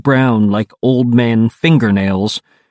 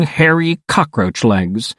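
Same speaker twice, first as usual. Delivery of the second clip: ordinary delivery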